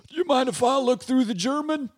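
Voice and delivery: high, pleading character voice; a voiced sheriff's helper begging politely; pleading sidekick. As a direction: ask timidly for a turn. Character asks to use telescope.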